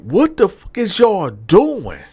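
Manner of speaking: voicing a deputy stunned at the cell; disgusted outrage. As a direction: stretch it out, appalled. Deputy discovering the scene.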